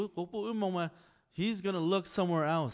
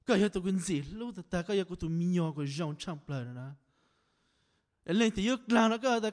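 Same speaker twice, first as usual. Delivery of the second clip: normal talking voice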